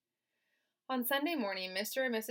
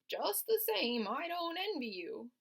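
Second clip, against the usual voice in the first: put-on old sheep voice; 9 semitones higher than usual.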